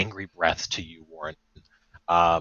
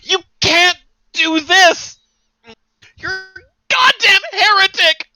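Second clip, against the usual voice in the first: much higher, louder, slow anguished scream; screaming in pain; 8 dB louder than usual. Acted. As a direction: scream at the enemy through the pain of a lost arm. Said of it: "screaming and crying and holding his fucking bloodied arm".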